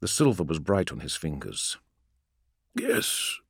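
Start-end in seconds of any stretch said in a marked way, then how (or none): 2.8-3.4 whispered. Acted. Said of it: "he said".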